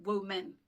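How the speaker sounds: WOMEN said woe-MEN, as two words; pronounced as written. Shown as a demonstration. Teacher showing the misleading spelling, said as written. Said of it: "even though it's written".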